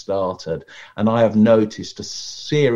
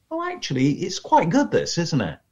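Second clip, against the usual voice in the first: much higher, mock grudging surprise; pleasantly surprised audience; 6 semitones higher than usual. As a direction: act won over despite yourself. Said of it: "audience goes".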